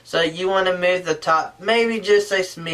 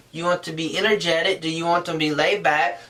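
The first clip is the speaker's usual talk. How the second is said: ordinary talk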